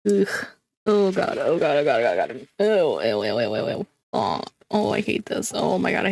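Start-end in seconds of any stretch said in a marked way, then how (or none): 1.4-2.5 low strained groans of disgust; disgusted groaning. Real, not acted. Squishing gross slime with hands. 2.6-3.9 squeamish ews. Real, not acted. Touching sticky whipped slime.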